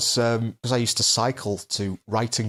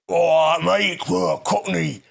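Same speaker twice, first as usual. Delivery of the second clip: loud, slow mock cockney chef; brash cockney shout; cockney accent; 6 semitones higher, 3 dB louder, slower than usual. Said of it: "I did a cockney voice".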